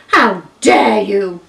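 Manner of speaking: loud, slow, outraged giraffe; slow furious how dare you; indignant shout. Acted. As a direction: roar it, deeply insulted. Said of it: "and shouted at the elephant again".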